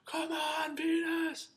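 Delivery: very high, strained yell; re-enacted shout. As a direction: shout it like an excited fan, volume held back. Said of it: "And I shouted out".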